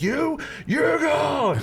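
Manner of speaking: high, slow mock shout; umpire ejection. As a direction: yell the ejection like a showboating umpire. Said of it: "he's like".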